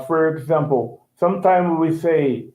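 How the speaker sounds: usual speaking voice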